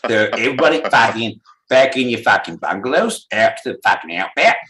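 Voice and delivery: put-on a light Australian accent with a bit of swagger; Aussie bloke ordering everyone home, lightly put on; mock Australian. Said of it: "It is Australia".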